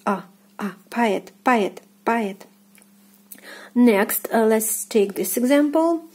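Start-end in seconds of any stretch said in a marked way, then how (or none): 0.1-2.4 "a" as short ah, then "poet" said pa-YET; "poet" with an ah in the first syllable; unstressed O as a. Shown as a demonstration. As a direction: show "a" alone, then Russian "poet" stressed on the end. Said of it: "It is pronounced as a".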